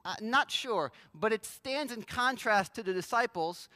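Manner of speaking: ordinary delivery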